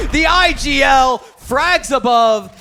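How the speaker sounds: shouting hype caster call; esports caster. Acted. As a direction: yell the clutch play-by-play.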